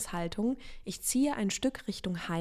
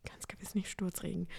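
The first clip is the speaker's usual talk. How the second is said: whispered aside; 10 dB quieter than usual. Real, not acted. Muttered self-correction aside.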